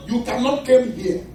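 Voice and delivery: "come here" said as "kem hee-ya"; mock-fancy "kem" for "come"; wicked English. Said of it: "I spoke wicked English".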